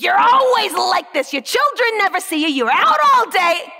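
loud and high, nagging a wayward husband; a staged public scolding, loud and high; fake angry shouting. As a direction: yell at him like a furious wife. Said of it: "Starts just shouting at this priest".

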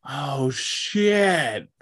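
a mock startled "oh shit"; imitating a stunned grower; amazed. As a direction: pitch jumps up in delighted shock. Voicing a grower's amazed reaction.

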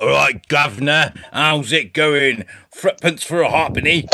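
hammed-up Cockney "guv'nor" accent; broad mock Cockney at near-normal pitch. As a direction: send up a working-class London accent. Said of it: "I'm kind of in between".

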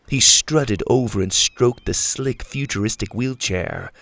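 ordinary delivery

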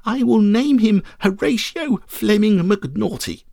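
put-on high, slow, nasal childish whine; whiny little boy drawing out every word through the nose; nasally whiny boy. A narrator's reading. Boy naming his new puppy, slow nasal whine. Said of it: "in a rather nasally and whiny voice".

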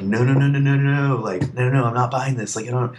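high, rapid-fire string of noes; frantic mock denial. Customer insists he isn't buying the bass, frantically protesting.

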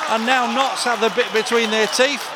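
ordinary talk

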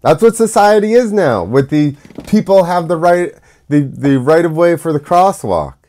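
usual speaking voice

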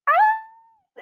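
very high-pitched mock scream; retold excited scream. Young actor re-enacting news of booking a role, mock scream. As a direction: imitate a scream of joy, high but held back. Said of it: "burst out screaming".

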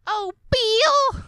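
high, loud wailing call; annoying female character. Acted. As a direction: cry out his name in an annoying screech. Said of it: "Her voice is so annoying".

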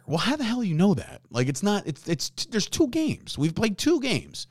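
ordinary talk

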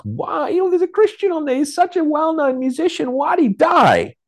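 sarcastic high-pitched parody of baffled churchgoers; mocking whiny Christians. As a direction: mimic the puzzled believers in a silly high voice. Mocking what Christians asked.

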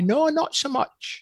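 high, drawn-out mock verdict; imitated Scottish listeners. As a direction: draw it out high, quoting doubtful Scots. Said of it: "when the Scottish people hear me".

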